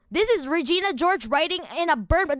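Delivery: usual speaking voice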